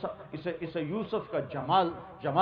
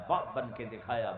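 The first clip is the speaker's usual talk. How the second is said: ordinary talk, nothing marked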